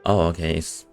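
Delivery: usual speaking voice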